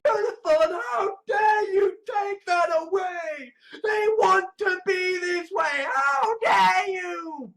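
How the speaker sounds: high-pitched, wailing mock outrage; hammed-up indignant wail, pitched high. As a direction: wail it like a wronged protester. Acted outraged plea, clearly high.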